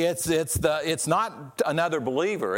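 normal talking voice

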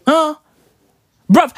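normal talking voice

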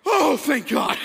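loud, high sigh of relief; acted huge relief. Pastor learning stranger is churchgoer, hammed-up relief. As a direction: play up the relief comically.

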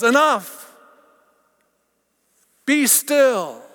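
high and loud command. Acted. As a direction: cut through the noise firmly. God commanding amid chaos.

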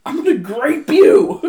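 slow, high, loud villain threat; mock creepy villain; hammed-up menacing quote. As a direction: drag out the threat like a cartoon villain. Quoting show line.

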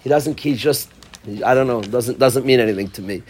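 ordinary talk, nothing marked

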